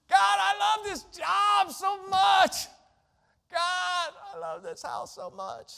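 hammed-up over-the-top thanks to God; mock gushing prayer. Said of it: "Again, I'm being facetious".

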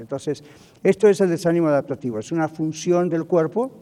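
ordinary talk

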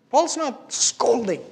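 ordinary talk, nothing marked

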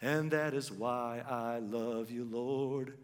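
very slow, measured recited verse; poem recitation. Acted. Pastor closing the sermon reciting a love poem, very slow. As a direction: recite it like a hymn line by line.

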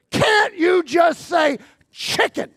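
loud, slow, fed-up yell at the church lady; exasperated shout. Retold or imitated. Frustrated guy shouting about the chicken, loud exasperated yell. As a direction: bellow it like a man who just wants chicken. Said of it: "the dude is going".

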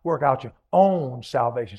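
"own" set apart and drawn out; stress on OWN. Shown as a demonstration. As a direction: hit "own" firmly for the congregation.

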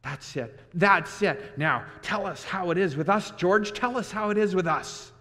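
put-on a big man excited like a child; delighted Lenny. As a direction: beg for the story with glee. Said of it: "Lenny was delighted".

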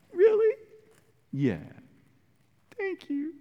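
high, small and soft voice; sniffly little thanks. Retold or imitated. Ahab to Jezebel, high sniffly thanks. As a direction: sniff a grateful thank you. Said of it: "He cries like a little girl".

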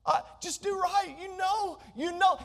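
God softly pleading with Cain to do right. Acted. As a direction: beg him gently to come round. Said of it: "He's pleading with this".

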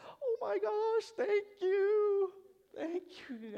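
very high, slow, teary mock delight; gushing mom. Mom thanking her kid for the present, high gushing mock tears. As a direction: melt over the gift like it's priceless.

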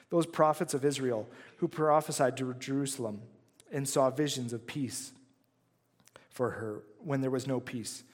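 normal talking voice

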